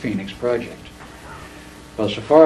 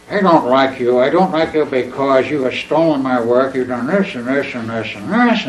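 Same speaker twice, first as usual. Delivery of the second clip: put-on drunken voice; 3 semitones higher, 6 dB louder than usual. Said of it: "in a very drunken tone of voice".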